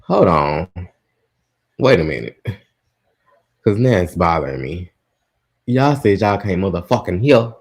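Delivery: ordinary delivery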